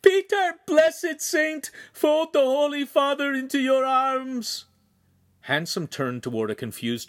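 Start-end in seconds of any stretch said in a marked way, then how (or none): 0.0-4.7 very high, loud wail to heaven; shrieked, grief-stricken plea; screamed prayer. A narrator's reading. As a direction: scream it skyward, drawing out the words. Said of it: "she screamed toward the ceiling".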